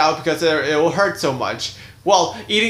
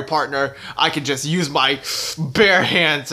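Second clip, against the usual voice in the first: ordinary delivery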